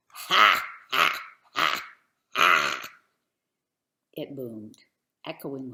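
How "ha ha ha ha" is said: deep, loud booming giant laugh; terrible giant laugh. A narrator's reading. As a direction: let the villain's laugh boom out. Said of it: "he laughed his terrible laugh".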